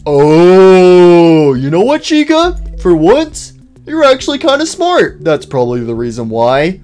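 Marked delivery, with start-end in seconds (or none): 0.0-5.2 long oh rising into high-pitched wonder; amazed realisation. Acted. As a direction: voice climbs high as it dawns on you. Sudden realisation, praising Chica.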